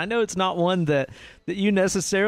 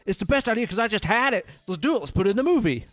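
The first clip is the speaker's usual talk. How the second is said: higher, quick, eager pitch; excited movie pitch; 6 semitones higher, faster than usual. Acted. Filmmaker pitching a movie idea he just had, excited. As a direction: pitch your brilliant idea fast and high.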